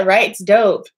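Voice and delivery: ordinary talk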